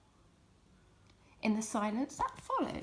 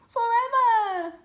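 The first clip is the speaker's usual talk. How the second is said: loud, much higher joyful cry; cried out; 13 semitones higher, 12 dB louder, slower than usual. A narrator's reading. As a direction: cry out in delight. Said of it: "they cried".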